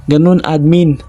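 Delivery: plain reading voice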